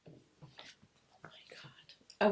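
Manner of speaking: breathless shocked whisper; whispered oh my God. Real, not acted. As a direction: gasp it out under your breath. Shocked reaction to stalker pick.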